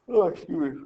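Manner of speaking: words stretched through a yawn. Real, not acted. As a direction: say it mid-yawn. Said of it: "I'm yawning".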